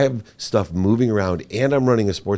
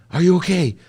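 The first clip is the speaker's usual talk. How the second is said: much higher, louder worried mother, imitated; worried mom; 11 semitones higher, 6 dB louder than usual. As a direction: mom cutting in, only caring if he's okay. Mom ignores the bad news.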